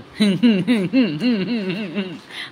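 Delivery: imitating a celebrity's giggly laugh, lightly done; a mock laugh put on in his style; Rahul Mahajan laugh. Roasting the aunt, vlogger mimics Rahul Mahajan's laugh. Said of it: "या मावशीमुळे आज मला राहुल महाजन बनावं लागलं".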